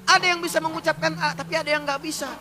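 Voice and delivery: ordinary delivery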